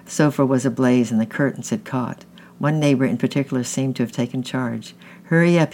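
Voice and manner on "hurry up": louder and higher urgent yell; shouted. A narrator's reading. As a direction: call out to hurry the crowd. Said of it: "he shouted".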